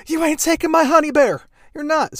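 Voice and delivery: very high, loud hammed-up defiance; mock jealous lover. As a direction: squeal it like a possessive sweetheart. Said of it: "he's like".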